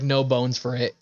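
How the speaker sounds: usual speaking voice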